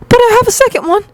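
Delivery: put-on very high-pitched, eager-to-please boy; timid student voice. Student offering his teacher a second song, timid high voice. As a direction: pipe up in a small high voice.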